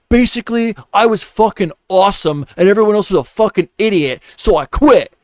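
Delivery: high, emphatic mock boasting; mock arrogant know-it-all. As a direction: imitate a cocky candidate bragging. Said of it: "he thinks he knows everything".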